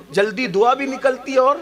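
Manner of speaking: ordinary talk, nothing marked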